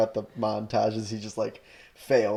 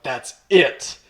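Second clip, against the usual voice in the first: higher, louder and slowing, a hammed-up revelation; mock eureka; 6 semitones higher, 7 dB louder, slower than usual. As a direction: stretch out the big discovery, pitched up. Hero's mock eureka at bar.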